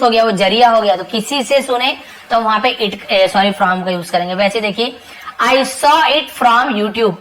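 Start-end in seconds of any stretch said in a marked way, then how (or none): 5.4-7.1 very slow, deliberate example sentence; slow teacher demo; slow dictation. Shown as a demonstration. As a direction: read it out slowly so students follow. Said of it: "slow model sentence for learners".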